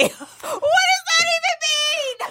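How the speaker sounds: high, breaking with laughter; can barely get it out for laughing; squealing through laughter; laughing hard. Real, not acted. Cracking up at her own line.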